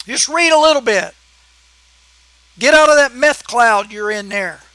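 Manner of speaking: fired-up angry rant. Real, not acted. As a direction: vent at the liberal commenter. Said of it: "I'm sorry to rant".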